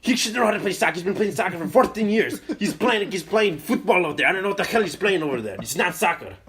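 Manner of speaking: high, loud indignant imitation; dad's exasperated rant. Dad defending himself to mom about son, exasperated rant.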